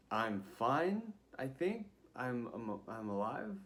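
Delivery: tentative, halting, with questioning rises; retold puzzled self-assessment; unsure answer. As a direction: answer as if asking whether it's true. Unsure answer to worried officer.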